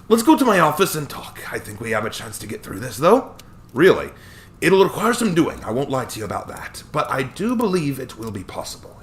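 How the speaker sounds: put-on chuckling old attorney, light Scottish touch; cheerful old Scottish lawyer. Hammond continues.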